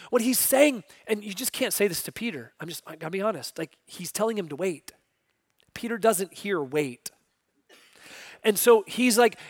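ordinary delivery

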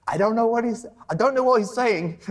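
put-on high, loud little girl in a rough Welsh accent; mock a child's Welsh-accented complaint. Six-year-old complaining to her dad, Welsh accent. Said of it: "that's, like, a Welsh accent".

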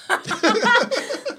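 high, laughing get it; laughing punchline. Real, not acted. Teasing listeners there's no sex talk tonight, laughing. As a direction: break into a laugh on the tease.

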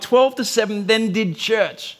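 ordinary delivery

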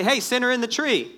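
high mock hail to someone; mock accusing call. Mock scolding call at sinner.

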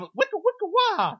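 high-pitched DJ scratch noises; mock record scratch. Joking through the Irish rap, mock scratch sounds.

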